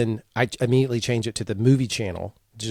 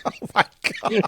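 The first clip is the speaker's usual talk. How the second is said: helpless high laughter through the words; laughing hard; 10 semitones higher, faster than usual. Real, not acted. Co-host reacting to the awful impression story, cracking up. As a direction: barely get it out for laughing.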